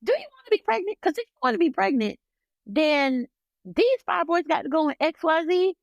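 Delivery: high, pushy imitation of the ultrasound tech; mocking the tech's voice. Ultrasound tech pressing a grieving patient, high mocking imitation. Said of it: "she literally asked me".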